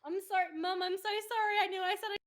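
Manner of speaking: real laughter, high and very loud; laughing apology. Real, not acted. Apologising to mum for swearing, cracking up laughing. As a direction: apologise loudly through helpless high laughter.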